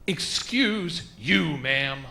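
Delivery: very slow, deliberate comeback; staring her down. Retold or imitated. Comic answering the offended woman face to face, staring her down. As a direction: draw out each word in measured rebuke. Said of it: "I stared her back in her face".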